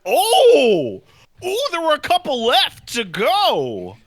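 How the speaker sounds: high, loud gasping "oh" of discovery; mock surprised delight. Midthirties friend acting out finding brand new emotions, mock amazed. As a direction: act out a big, high-pitched pleasant surprise.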